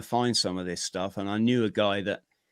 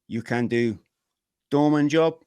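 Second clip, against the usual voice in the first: imitating a Hong Kong Chinese boss's accent, rising on doorman job; clipped Hong Kong Chinese English, last words higher and louder; 3 semitones higher, 4 dB louder than usual. Nightclub boss offering the doorman job, Hong Kong Chinese accent. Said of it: "I'm doing the accent".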